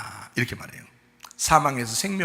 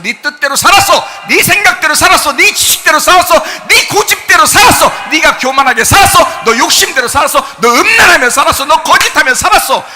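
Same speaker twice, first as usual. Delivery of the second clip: high shouted accusation, hammered phrase after phrase; full-force preaching; 11 semitones higher, 15 dB louder than usual. Real, not acted. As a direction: thunder the charges at the sinner. (고성으로 꾸짖는 부흥회 설교)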